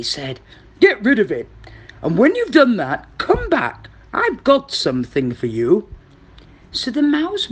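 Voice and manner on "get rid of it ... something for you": loud crocodile giving orders. Acted. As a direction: instruct the mouse as the crocodile. Crocodile instructs the mouse.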